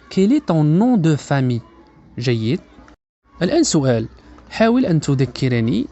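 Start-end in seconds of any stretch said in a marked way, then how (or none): 0.1-1.6 slow but connected teacher's model; measured, linked-up phrase for learners; joined-up model phrase. Shown as a demonstration. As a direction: say it in one careful, unbroken run. Teacher then joins the phrase.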